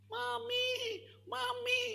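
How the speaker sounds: put-on high wailing little girl calling Mami; crying child. (anak kecil menangis memanggil mami)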